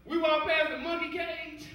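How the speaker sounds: usual speaking voice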